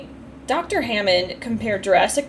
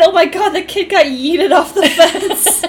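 higher, louder, slow, laughing all through; laughing quote; 6 semitones higher, 3 dB louder, slower than usual. Retold or imitated. Friend retelling her reaction to the fence scene, laughing loudly. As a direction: squeal it out between laughs. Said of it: "I said".

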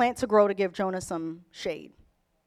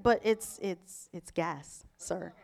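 soft, low, halting objection; imitating a hesitant niece, slowing down; timid reasoning; 7 semitones lower, 11 dB quieter, slower than usual. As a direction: protest gently, voice dropping away. Voices niece timidly reasoning with uncle.

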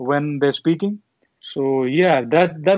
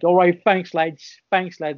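put-on Cockney accent; 2 semitones higher, slower than usual. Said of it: "That's how they, you know, say".

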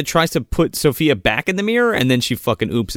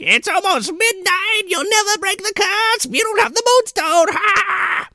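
gloating witch. Retold or imitated. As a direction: crow over them like a storybook witch. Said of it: "and she's like".

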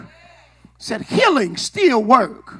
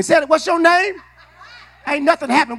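ordinary talk, nothing marked

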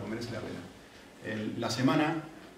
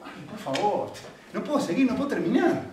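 retold exasperation; 6 semitones higher than usual. Husband begging wife to let him work, exasperated. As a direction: voice climbing in a frustrated plea.

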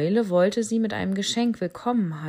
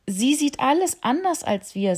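put-on much higher, louder rhino child; little rhino tattling to the owl; young rhino; 8 semitones higher, 5 dB louder than usual. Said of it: "sagte das Junior-Nashorn". (hohes, lautes Nashornkind)

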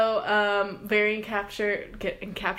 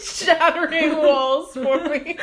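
laughing; 7 semitones higher, 7 dB louder than usual. Real, not acted. Laughing while joking with friend.

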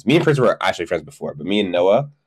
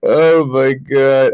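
stunned; 6 semitones higher, 4 dB louder, much slower than usual. Real, not acted. Reacting to old Ask FM.

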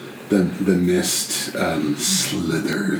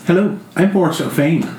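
higher, brisk put-on voice; character introduction; 9 semitones higher, 3 dB louder, faster than usual. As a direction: announce yourself brightly. New character introduces himself.

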